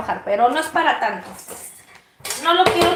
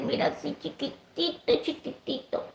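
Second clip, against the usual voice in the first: cooing softly; 10 dB quieter than usual. Real, not acted. Cooing over tiny egg.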